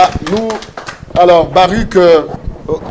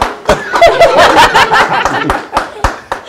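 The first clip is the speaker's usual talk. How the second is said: laugh. Real, not acted. Host laughing on live set.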